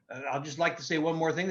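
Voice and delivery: ordinary delivery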